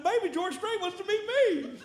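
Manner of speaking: a fan bursting to tell his wife; high giddy fan squeal, laughing; giddy excitement. Retold or imitated. Giddy telling wife the news.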